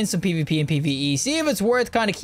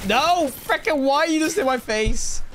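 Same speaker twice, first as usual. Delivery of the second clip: startled protest; 8 semitones higher, slower than usual. Real, not acted. Player ambushed up close, startled higher cry. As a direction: yelp as he's jumped.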